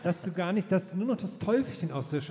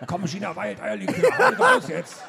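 devil egging on; 7 dB louder than usual. Acted. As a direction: push harder and harder, shrieking.

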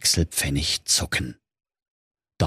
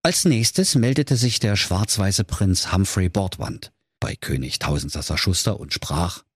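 plain reading voice

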